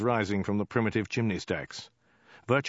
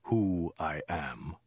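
low, very slow and deadened; slow, lifeless low delivery; low dead voice; 4 semitones lower, 3 dB quieter, much slower than usual. A narrator's reading. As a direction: intone it with slow menace. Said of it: "in a low, dead voice".

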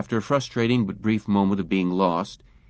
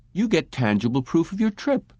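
firm, emphatic salesman; emphatic disagreement; 7 semitones higher than usual. A narrator's reading. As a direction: press the point home. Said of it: "disagreed emphatically".

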